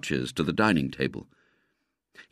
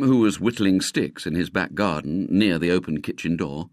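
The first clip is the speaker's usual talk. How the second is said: ordinary delivery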